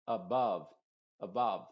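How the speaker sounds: ABOVE as uh-BUHV; same short 'uh' in both syllables of ABOVE; same vowel twice. Shown as a demonstration. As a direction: say ABOVE with relaxed, unrounded vowels. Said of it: "usando la misma vocal".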